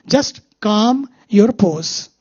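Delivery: calm your pose said very slowly; slow calm mantra. Shown as a demonstration. Teacher giving the mantra to the audience, slow and drawn-out. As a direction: draw each word out, soothing. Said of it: "Why you are so agitated".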